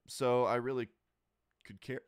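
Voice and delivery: talking through hiccups and burps; interrupted by a hiccup mid-phrase. Real, not acted. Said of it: "Sorry, I'm burping and hiccuping".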